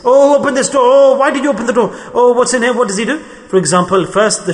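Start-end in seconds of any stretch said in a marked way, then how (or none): 0.0-3.2 higher, louder nagging complaint; quarrelsome outburst. Retold or imitated. Angry person quarrelling at home, loud complaint. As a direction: pick a fight over a door.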